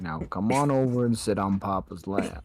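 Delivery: put-on Texan accent, pitched well down; deep southern voice. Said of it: "Why did you go so southern".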